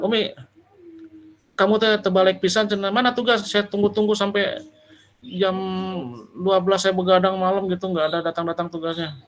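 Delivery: hammed-up angry lecturer telling a student off; snapping scold. Lecturer snaps at student Umi over a late assignment, mock angry scolding. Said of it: "sekedar dibentak".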